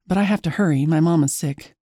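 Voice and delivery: usual speaking voice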